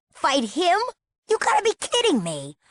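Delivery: high-pitched scoffing disbelief; incredulous. Acted. Captive girl to her would-be rescuer, incredulous. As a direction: balk in disbelief, pitch leaping up.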